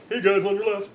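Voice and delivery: breezy jogger calling out as he zooms by; cheery zombie runner. Pretend. Zombie politely warning runners as it passes, chirpy call. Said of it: "just like".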